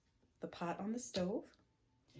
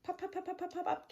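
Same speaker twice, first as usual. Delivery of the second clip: popping sounds; 11 semitones higher, faster than usual. Acted. Popcorn sound effects.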